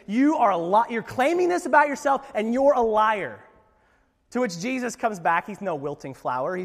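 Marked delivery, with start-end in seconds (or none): 0.1-3.4 accusing Pharisees. Retold or imitated. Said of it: "they just".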